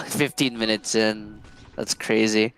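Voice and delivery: ordinary talk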